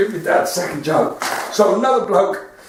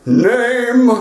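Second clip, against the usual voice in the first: louder, gruff gatekeeper voice; Saint Peter voice; 5 dB louder than usual. Retold or imitated. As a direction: bark the question like a doorman.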